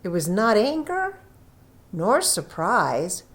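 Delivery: ordinary delivery, nothing marked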